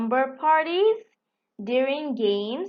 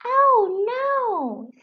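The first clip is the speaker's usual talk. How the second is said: sharp high cry of dismay; 11 semitones higher, 4 dB louder than usual. Acted. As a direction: recoil from the praise. Firenze dismayed.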